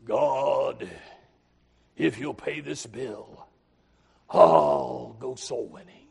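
slow, low pleading voice, lightly acted; a hint of a needy man bargaining with God; slow, low mock plea to God; mock bargaining prayer. Preacher acts a deal-making prayer.

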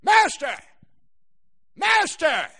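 desperate cry of master; cried out. Acted. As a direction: call out in panic. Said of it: "And they cried out".

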